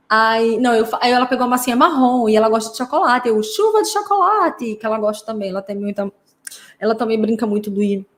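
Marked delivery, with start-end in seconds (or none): 3.4-4.8 high, drawn-out playful announcement; brincadeira com criança. Retold or imitated. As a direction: call it out slowly in a high child-directed voice. Recria brincadeira com paciente criança. (anúncio brincalhão, agudo e alongado)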